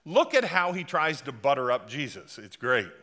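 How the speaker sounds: ordinary talk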